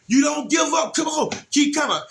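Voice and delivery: high retold shout; urgent encouragement. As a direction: cheer on a struggling swimmer. Shouting encouragement to struggling daughter.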